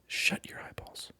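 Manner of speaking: ordinary delivery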